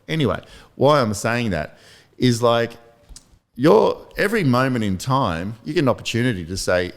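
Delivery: usual speaking voice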